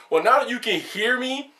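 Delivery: ordinary talk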